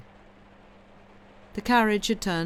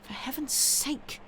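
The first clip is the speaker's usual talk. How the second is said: whispered, high, slow cry; soft cry; 10 semitones higher, 4 dB quieter, slower than usual. A narrator's reading. The young woman whispering in alarm to Franz. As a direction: gasp it out under the breath. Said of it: "she cried softly".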